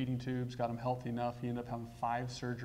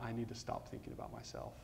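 whispered thought; 11 dB quieter than usual. Retold or imitated. Wife's inner thought, whispered.

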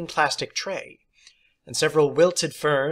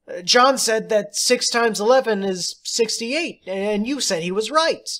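put-on high, louder child voice; tattling girl; 9 semitones higher, 5 dB louder than usual. Girl telling the teacher John was wrong, high. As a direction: report it like a pupil telling on him.

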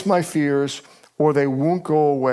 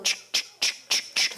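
mocking ch noise; 9 dB quieter than usual. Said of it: "Every one of those comments was like".

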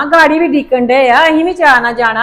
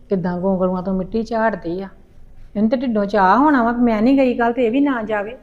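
muttering under breath; 5 semitones lower, 10 dB quieter than usual. Acted. Mother grumbles after daughter speaks.

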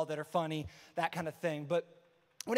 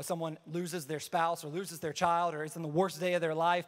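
normal talking voice

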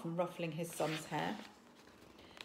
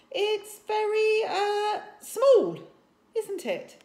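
high, loud, slow halting chief voice; awkward chief; 11 dB louder, slower than usual. A narrator's reading. Father hunts for words about the tiny dragon: high halting. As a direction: stumble for a kind word.

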